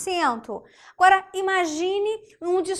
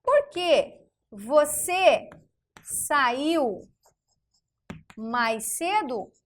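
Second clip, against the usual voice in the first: slow dictation; much slower than usual. Shown as a demonstration. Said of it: "Vamos pegar o porquê".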